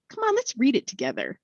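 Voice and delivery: lightly voiced insistent lady; eager lady voice.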